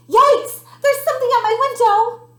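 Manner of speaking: high, loud, rushed alarm; frightened cry. A narrator's reading. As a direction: yelp in fright, words tumbling fast. Frightened by noise at night.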